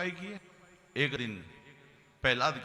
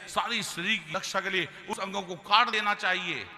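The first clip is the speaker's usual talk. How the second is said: normal talking voice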